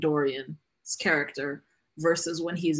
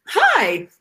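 much higher, much louder bright chirp; mock cheery email greeting; 12 semitones higher, 10 dB louder than usual. Mimicking her own upbeat work emails, bright mock greeting. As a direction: burst out with exaggerated friendliness. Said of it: "Qu-, exclamation points".

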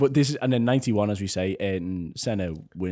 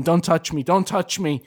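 louder, pushed-away don't touch me; aggressive snap; 8 semitones higher, 8 dB louder than usual. Retold or imitated. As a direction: snap at everyone to back off. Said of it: "he's going".

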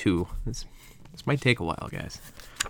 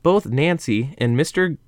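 slow, raised reading voice; story narration; 7 semitones higher, 4 dB louder, slower than usual. A narrator's reading. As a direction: read it like a dramatic storyteller. Reads the story aloud.

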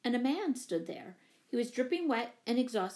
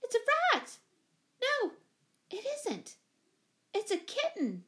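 put-on very high-pitched girlish squeal; 13 semitones higher than usual. A narrator's reading. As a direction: squeal it out, startled and excited. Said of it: "squealed Jane".

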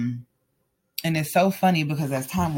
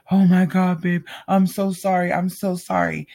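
higher, pleading apology in imitation; acted remorse; 4 semitones higher, 5 dB louder than usual. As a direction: mimic him saying sorry over and over, pitched up. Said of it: "acting all remorseful".

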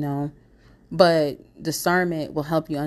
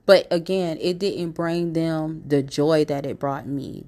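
normal talking voice

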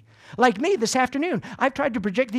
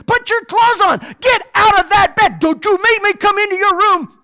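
high, loud parent shriek; screaming the kids out of bed; mock yelling at kids; 9 semitones higher, 11 dB louder than usual. Mom yells at kids on Sunday morning, loud and high. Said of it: "yelling and screaming".

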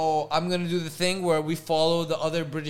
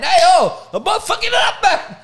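high shouted fake Jamaican accent; mock Jamaican accent; 12 semitones higher, 9 dB louder than usual. Host admits to botching the accent, shouting in fake Jamaican. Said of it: "I don't know what accent this is".

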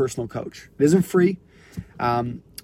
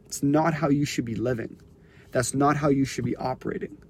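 ordinary talk

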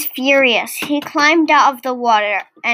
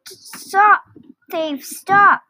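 higher-pitched cry of pursuit; shouted alarm; 7 semitones higher, much slower than usual. A narrator's reading. Pursuers chasing Toad the thief, shouting. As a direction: call out in urgent, separate bursts. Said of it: "Toad heard something shouting".

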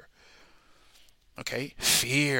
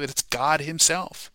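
normal talking voice

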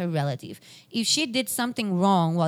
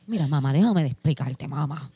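imitating dad; 6 semitones lower than usual. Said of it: "imitando a mi papá".

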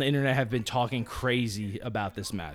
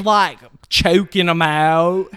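put-on slow, much louder southern accent; 3 semitones higher, 9 dB louder, much slower than usual. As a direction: drawl it out slowly in a hammed-up southern accent. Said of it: "[name]'s talking in a southern accent".

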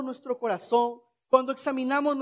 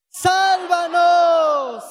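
long drawn-out shout, higher and louder; 5 semitones higher, 6 dB louder, much slower than usual. Shown as a demonstration. Preacher leads the church shouting for salvation, loud drawn-out cry. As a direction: cry out for salvation with full force. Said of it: "Otra vez".

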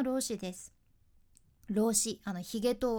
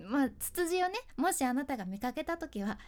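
ordinary delivery

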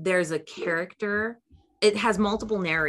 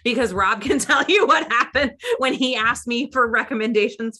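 laughing; 5 semitones higher, 6 dB louder than usual. Real, not acted. Laughing about past book recommendation.

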